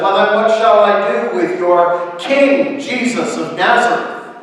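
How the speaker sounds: high, forceful shouted question; loud challenge. A narrator's reading. Pilate asks the crowd about Jesus, shouting. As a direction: challenge the mob at full volume.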